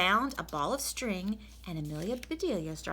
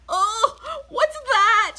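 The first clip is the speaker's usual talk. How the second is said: much higher, louder startled cry; shouted; 13 dB louder, much slower than usual. Acted. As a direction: yelp in disgust at the sticky blob. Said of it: "she shouted".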